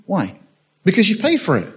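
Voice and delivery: ordinary talk, nothing marked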